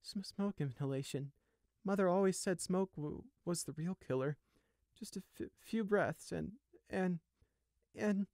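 high, faltering voice; dazed stammering. A narrator's reading. Sheep answers himself in shock, dazed and stammering. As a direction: stumble through it, trailing into broken repeats. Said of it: "mouth answering his own question without any input".

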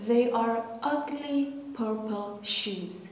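slow careful reading; slow model sentence. Shown as a demonstration. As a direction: read slowly for learners. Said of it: "model sentence". (آهسته و شمرده)

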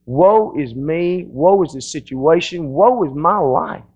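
slow, drawn-out woe is me; mock self-pity. Believer at a pity party, slow mock lament. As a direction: moan it slowly, wallowing. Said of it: "we call the pity party".

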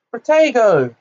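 high, loud urgent incantation; shouted spell. A narrator's reading. Harry blocks the returning spell, shouting. Shield spell cast in a duel.

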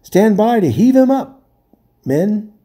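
high, loud order; king ordering sailors at the rail; command. A narrator's reading. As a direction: bark it to the crew. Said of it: "said Caspian".

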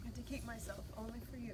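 soft, choked-up vow; emotional vow repeat. Real, not acted. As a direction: hold back tears, softly. Bride repeats vows softly.

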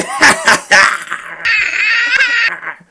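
imitated laughing fit. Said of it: "Paul's like".

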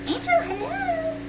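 high slow coo for the dog; slow high puppy greeting; pet voice. Real, not acted.

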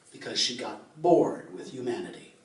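usual speaking voice

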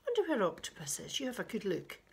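ordinary delivery

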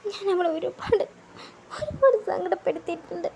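high, weepy confession; crying. Acted. [name] crying as he confesses to [name]. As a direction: confess the hurt while crying. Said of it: "നീ എന്തിനാ കരയുന്നേ?".

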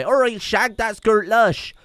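sing-songy West Country lilt, pitched high; West Country accent. Shown as a demonstration. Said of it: "speak with a West Country pirate accent".

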